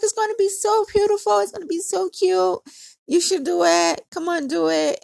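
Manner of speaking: high, gushing persuasion; mimicked excited pleading; imitated eager coaxing. Friend coaxing her into dreads.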